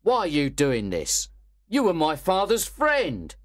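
angry protest. Acted. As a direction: protest hotly. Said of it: "Monks was very angry and said".

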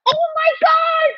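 high, drawn-out shout of disbelief; real goal celebration. Real, not acted. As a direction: scream it as your team scores. Erupts as Luke Shaw scores.

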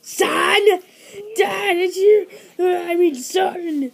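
excited. Acted. Excited reunion.

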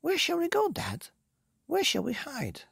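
put-on very high child character voice; eager, high-pitched youngsters; excited little ones. A narrator's reading. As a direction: ask Dad in an excited high pipe. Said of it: "cried the two little ones".

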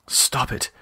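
soft unvoiced whisper; breathy whispered plea to herself. A narrator's reading. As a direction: hush it to herself. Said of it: "she said aloud".

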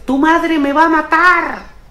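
high, loud, drawn-out yell; panicked shout. Acted. Father yelling at his fallen kid, panicked shout. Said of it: "Lo primero que le grito es".

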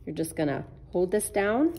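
ordinary talk

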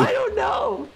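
laughing shout. Real, not acted.

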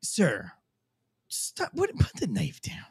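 soft, low, whispered plea; calm quiet talk. Shown as a demonstration. Cop quietly reasons with the knife man. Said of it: "maybe you went".

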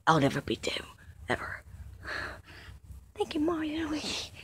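soft, hushed whisper. Acted. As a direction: breathe the words gently. Quiet tender reunion moment.

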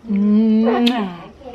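drawn-out smacking kisses. Real, not acted. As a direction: mwah kiss sounds. Kiss sounds to baby.

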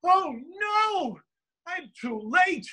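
high-pitched wail of despair; anguished cry. Acted. Son thinking his mother has just died, crying out. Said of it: "And I literally cried out".

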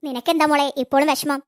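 normal talking voice

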